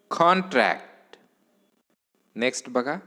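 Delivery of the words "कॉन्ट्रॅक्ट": "कॉन्ट्रॅक्ट" with strong कॉन, falling tune; front-stress "कॉन्ट्रॅक्ट"; stress on first syllable. Shown as a demonstration. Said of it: "उच्चार बघा कसा करायचा". ("कॉन्ट्रॅक्ट" च्या पहिल्या अक्षरावर जोर)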